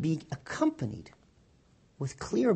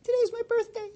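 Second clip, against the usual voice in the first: put-on high, hopeful childlike plea; little girl's prayer. Little girl asking God for a birthday doll, high. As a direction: ask God sweetly like a small child. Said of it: "she turns to God again".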